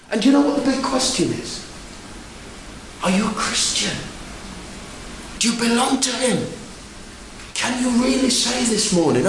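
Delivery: usual speaking voice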